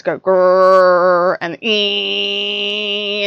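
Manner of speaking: growled grrr then thin ee; imitated grinder noise. Vlogger describing her old coffee machine, imitating its grinding noises. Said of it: "it would grind the beans".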